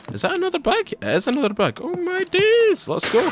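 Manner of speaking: high squealed excitement, voice shooting up; excited delight. Real, not acted. Streamer finds another bag mid-story, high excited delight.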